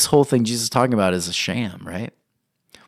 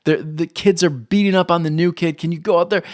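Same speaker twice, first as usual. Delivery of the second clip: pleading; 7 semitones higher than usual. Retold or imitated. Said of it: "I said".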